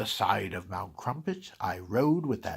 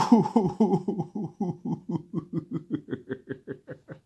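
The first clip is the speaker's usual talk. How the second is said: gloating laugh; 6 semitones higher, 6 dB louder than usual. Acted. Grinch gloating at the Whos' misery.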